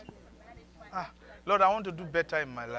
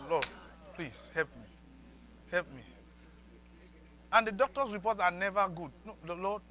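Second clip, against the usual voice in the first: usual speaking voice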